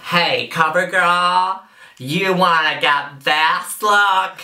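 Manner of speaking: slow, high Californian-accent commercial pitch; mock Californian accent. Shown as a demonstration. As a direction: drawl the ad line in a put-on Californian accent. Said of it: "mastered my Californian accent, ready for a CoverGirl commercial".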